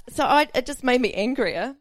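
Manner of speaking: normal talking voice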